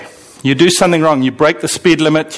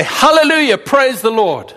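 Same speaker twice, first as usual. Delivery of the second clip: congregation rejoicing; 8 semitones higher than usual. Acted. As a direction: celebrate like a welcoming congregation. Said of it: "We would all say".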